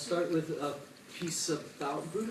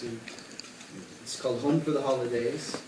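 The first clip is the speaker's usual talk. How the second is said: normal talking voice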